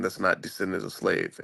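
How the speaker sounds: normal talking voice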